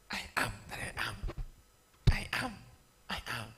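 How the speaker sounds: mysterious whisper. Acted. God names himself to Moses in a hushed whisper. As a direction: let the name hang in a hush. God's name spoken mysteriously.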